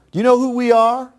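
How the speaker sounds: high, loud, menacing demand; menacing threat. Retold or imitated. Kidnappers threaten the old man outside his house, menacing. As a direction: loom over him with the question.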